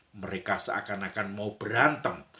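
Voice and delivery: usual speaking voice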